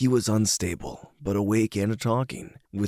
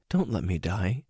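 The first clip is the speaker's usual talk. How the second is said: subdued sadness. Acted. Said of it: "She had the saddest look in her eyes and said".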